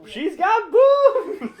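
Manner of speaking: very high, very slow and loud, laughing; imitating high voice. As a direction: copy the silly squeak and stretch it out. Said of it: "Is that gonna be the".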